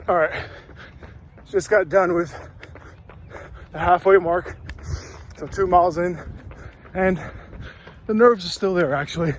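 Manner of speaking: talking mid-run, loud and breathless; speaking while jogging; while running. Real, not acted. Recording mid-run, first leg.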